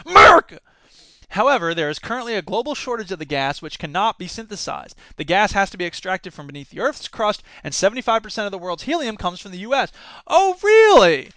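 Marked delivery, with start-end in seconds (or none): 0.1-0.6 loud, higher mock-patriot rally cry; louder hammed-up patriot; patriotic shout. Patriotic rant. 10.3-11.3 high, slow mock surprise; slow high incredulous oh really; sarcastic disbelief. Real, not acted.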